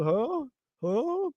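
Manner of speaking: confused huh. Retold or imitated. Zion confused amid a brawl.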